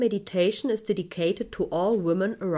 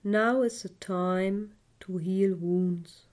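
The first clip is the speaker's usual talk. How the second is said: guided meditation. Acted. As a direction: guide the listener gently into calm.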